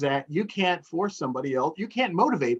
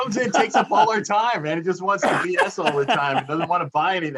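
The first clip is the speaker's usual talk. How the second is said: mock grumbling; 4 semitones higher, 5 dB louder than usual. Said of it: "I'm kidding, kidding".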